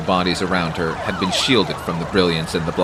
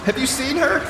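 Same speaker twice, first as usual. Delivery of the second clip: frantic mother. Acted. Frantic mother seeks daughter.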